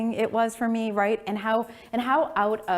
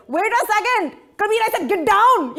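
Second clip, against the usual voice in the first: dad impression; 10 dB louder than usual. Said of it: "my dad's like".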